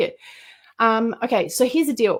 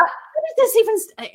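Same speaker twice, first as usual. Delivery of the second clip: amazed; 12 semitones higher than usual. Retold or imitated. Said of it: "And I'm like".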